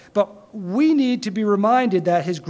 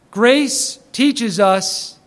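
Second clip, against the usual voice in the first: slow emphasis; 4 dB louder, much slower than usual. Shown as a demonstration. Slow emphatic teaching point.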